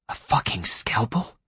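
slow, hushed disbelief; stunned, slow inner whisper; horrified whisper. A narrator's reading. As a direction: whisper it in horror. Klaas's horrified thought at the blade.

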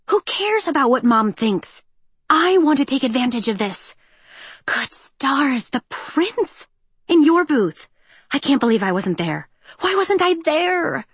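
high, breathless gushing; gushing excitement. Acted. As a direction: squeal about the prince. Peony gushing over the prince.

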